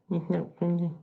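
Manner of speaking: ordinary talk, nothing marked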